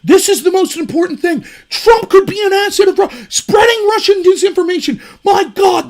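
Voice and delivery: mock panicked outrage over Russia; mock grandiose outrage. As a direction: ham up their grand claims, loud and high. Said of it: "they always make these grandiose claims".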